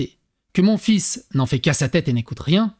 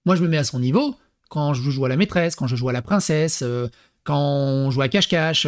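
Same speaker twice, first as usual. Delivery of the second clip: ordinary talk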